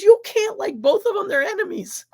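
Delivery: high-pitched mock outrage; imitated indignant scolding. Cousin sets boy straight on rival teams, imitated indignant scolding.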